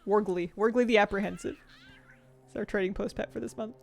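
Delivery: ordinary talk, nothing marked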